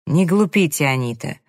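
ordinary delivery, nothing marked

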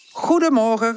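high, cheerful group greeting; calling out. A narrator's reading. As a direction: call it out in a high voice. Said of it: "roepen ze allemaal". (hoog roepend groeten)

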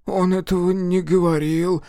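plain reading voice

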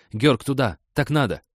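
urgent call. Acted. Mia urgently redirects him.

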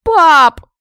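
very loud, high cry to Dad; piercing, outraged shout; horrified yell. Acted. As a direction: wail for Dad in horror. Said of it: "завопила я".

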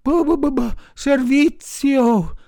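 high, loud dog voice, thanks broken by barks; high loud barking thanks; grateful barking dog. A narrator's reading. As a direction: stammer thanks between barks. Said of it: "rispose il cane".

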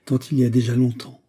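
ordinary delivery

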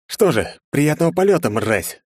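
spiteful farewell, high start dropping onto the insult; malicious send-off. A narrator's reading. Hero finishes off his enemy, malicious send-off. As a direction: savour the sarcasm, then spit the insult. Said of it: "злобно произнёс я".

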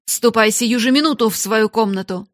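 loud, commanding order; angry shout. A narrator's reading. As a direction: send the girl away furiously. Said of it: "закричала она".

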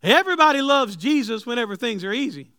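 loud, high preaching climb; preaching at full force. Acted. Preacher loudly telling the church life is not perfect. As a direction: press it hard on the church.

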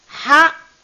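ha breathed loud from the middle of the throat. Shown as a demonstration. Teacher showing the middle throat letter, loud breathy demo. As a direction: push ha from mid-throat.

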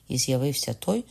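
ordinary delivery, nothing marked

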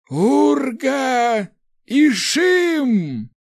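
high, loud incantation cry; spell shout. Acted. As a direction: hurl the spell words out at full voice. Rector casts a spell.

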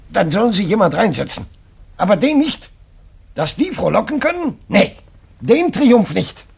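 angry outburst at his wife about the neighbour; furious refusal. Acted. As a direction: spit out the refusal, dragging the last words. Husband refusing the informer the room.

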